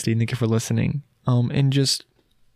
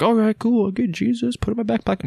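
mock casual; 8 semitones higher than usual.

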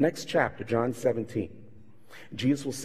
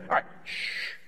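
hushing whisper; 5 dB quieter than usual. Retold or imitated. Shushing the storm.

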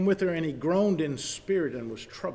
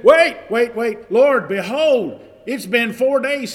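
much louder, higher, hurried wait wait wait; urgent protest; 5 semitones higher, 9 dB louder than usual. Acted. Martha to Jesus at the grave, urgent alarmed protest. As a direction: stop him in alarm.

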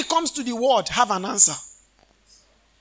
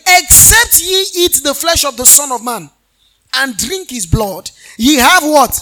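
loud, fervent preacher reading the Bible; preaching scripture; 2 semitones higher, 6 dB louder than usual. Acted. Pastor reading John six to the congregation, loud preaching. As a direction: proclaim the verses with rising fire, quizzing the flock.

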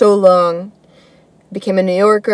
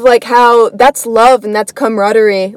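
normal talking voice

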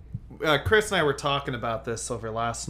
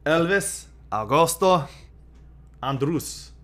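Spanish-style name; 3 semitones higher, 4 dB louder, slower than usual. Pretend. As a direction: savor every syllable like a stadium announcer. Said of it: "If you're gonna do a Mexican, like, accent".

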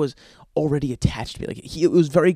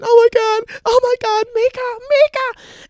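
high, hammed-up panicked scream; imitated screaming; 9 dB louder than usual. Girlfriend watches dog maul her bird, mock hysterical screaming. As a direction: shriek like the horrified girlfriend. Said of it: "she's screaming".

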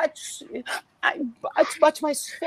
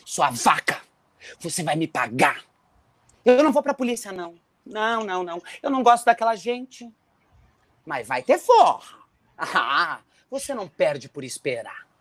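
much lower, quiet vengeful growl of a promise; low menacing threat; 9 semitones lower, 3 dB quieter than usual. Acted. Insulted man vows revenge.

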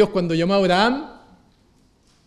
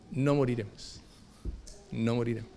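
1 hushed conviction; 5 semitones lower, 10 dB quieter than usual. Acted. Quoting Habakkuk softly.

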